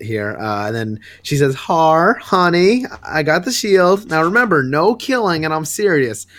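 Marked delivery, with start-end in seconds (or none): 1.5-6.3 higher, sing-song permissive mom, going easy on her; a lenient mom gently reminding her kid of the rules. Retold or imitated. Batgirl scolding Harley like an overly lenient mom, higher and sing-song. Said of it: "she sounds like a mom".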